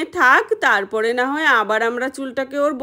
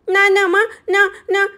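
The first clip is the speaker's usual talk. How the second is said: pleading refusal; 5 semitones higher than usual. Acted. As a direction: beg her mother to stop, pitched up. Girl refuses hair dye.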